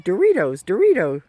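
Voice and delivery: loud sing-song bird call; bird call imitation. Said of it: "sounds like it's saying".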